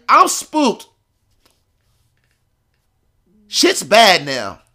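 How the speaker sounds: high, loud, spooked outburst; acted panic. Just-turned-eighteen teller fears prison, high loud panic. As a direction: let the dread push your voice up.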